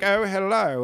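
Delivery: high, mocking British greeting; mock British accent. Comic imitating the English, mock British accent. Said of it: "They sound like fucking Michael Caine".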